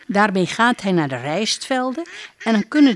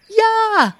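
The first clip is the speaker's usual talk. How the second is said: very high, louder duck yes; duck's cry; 4 dB louder than usual. Acted. Duck crying yes to the princess, very high. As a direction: squawk of delight. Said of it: "Boeng Bebek roept".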